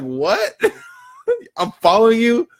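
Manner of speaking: high, incredulous laughing What; laughing disbelief. Retold or imitated. To the accusing stranger, a high laughing disbelief.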